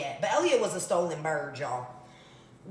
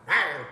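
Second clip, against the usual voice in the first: playful growl; 4 semitones lower, 4 dB louder than usual. Pretend. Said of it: "like a Rottweiler".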